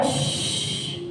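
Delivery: long imitated hushing "shh"; callar con un shh. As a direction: hush someone with a drawn-out shh. Mimics the hushing crowd. (siseo largo para mandar callar)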